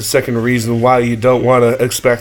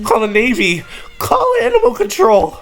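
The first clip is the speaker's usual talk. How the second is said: mock panic; 8 semitones higher than usual. Real, not acted. Calling for help as a joke.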